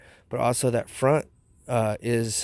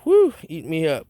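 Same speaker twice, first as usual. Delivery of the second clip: exclaiming; 5 semitones higher, 5 dB louder than usual. Real, not acted. Swatting mosquitoes outside.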